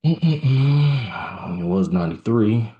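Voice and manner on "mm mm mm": high tense hum. Real, not acted. Watching trade, tense.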